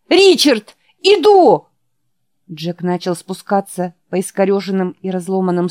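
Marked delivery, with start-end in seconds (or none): 0.1-1.7 high, loud urgent call; shouting. Acted. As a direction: yell across the hotel to a friend. Jack shouts to dying Richard.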